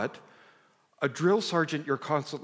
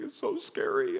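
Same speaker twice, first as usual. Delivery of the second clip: put-on very high little-boy voice; retold child's fright, pitched way up; frightened child. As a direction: imitate a scared child in a tiny high voice. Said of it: "he's trembling".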